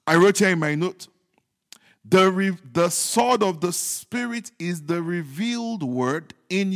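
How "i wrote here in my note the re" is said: ordinary talk, nothing marked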